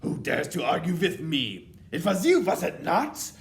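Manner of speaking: high, loud witch accusation; menacing witch interrogation; angry snap. A narrator's reading. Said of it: "she snapped".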